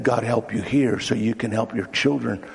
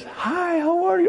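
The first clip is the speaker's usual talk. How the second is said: high-pitched, sing-out hello; gushing greeting. Acted. Psychiatrist greets her best client, gushing high voice.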